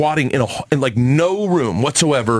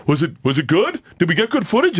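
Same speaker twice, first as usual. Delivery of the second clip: higher, eager voice of the producer; imitating the producer's eager question; mock producer; 5 semitones higher than usual.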